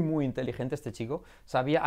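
ordinary delivery

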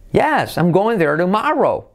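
high YES, slow swoop through "tomorrow"; affirmative intonation. Shown as a demonstration. Teacher models affirmative, slow high swoops. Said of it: "me dejaba la afirmativa".

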